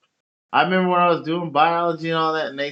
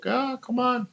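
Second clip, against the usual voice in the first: higher put-on voice, brushing it off; mock dismissive voice; 11 semitones higher, 4 dB quieter than usual. Imagining people dismissing his looks, high mock voice. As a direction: wave it away in a put-on voice. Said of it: "They'd probably just be like".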